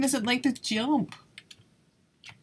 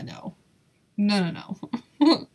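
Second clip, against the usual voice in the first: ordinary talk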